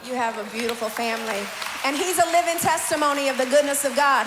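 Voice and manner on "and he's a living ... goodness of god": louder testimony proclaimed to the room; preaching proclaim. Real, not acted. Host praising God before the audience, proclaiming.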